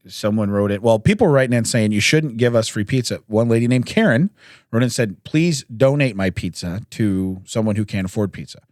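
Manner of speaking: usual speaking voice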